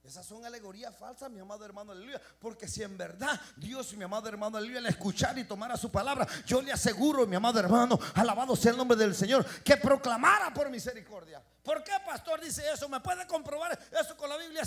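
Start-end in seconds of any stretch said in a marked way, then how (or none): 2.4-11.4 loud, rising shouted preaching; preaching at full force. Acted. Pastor roaring at the congregation, full-force preaching. 11.6-14.6 questioning church member, pitched higher and louder; mock doubting churchgoer. Pastor voicing a skeptic who challenges him, higher questioning.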